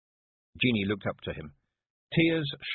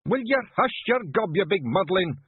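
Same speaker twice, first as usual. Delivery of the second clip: higher, louder Scots shout; 12 semitones higher, 5 dB louder than usual. A narrator's reading. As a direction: bark at the wailing lad to hush. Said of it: "shouted Rob Anybody".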